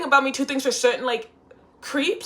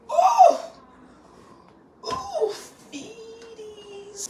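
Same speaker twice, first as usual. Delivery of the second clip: high squeaky coo at her feet; 14 semitones higher, much slower than usual. Real, not acted.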